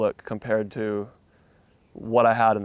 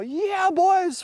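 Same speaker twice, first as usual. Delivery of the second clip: much higher call to the crew; high, jubilant shout-out; hyped cheer. Retold or imitated. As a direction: arm up, cheering the boys. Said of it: "I'm all".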